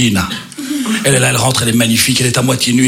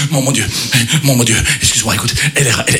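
ordinary delivery

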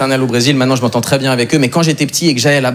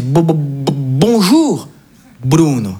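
moved grandfather stammering; much slower than usual. Retold or imitated. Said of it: "il, il est tout ému".

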